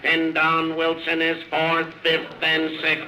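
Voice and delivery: brisk racetrack announcer play-by-play; race call. Acted. Announcer calls the race to the crowd, live play-by-play.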